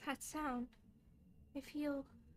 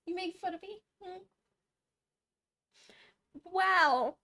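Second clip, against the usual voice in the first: usual speaking voice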